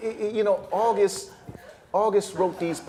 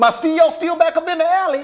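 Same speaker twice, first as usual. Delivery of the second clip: higher, louder brash entrance line; loud character entrance; 12 semitones higher, 7 dB louder than usual. Acted. Character bursting into the room, brash and loud. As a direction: barge in and claim the room.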